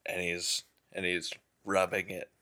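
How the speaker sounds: ordinary delivery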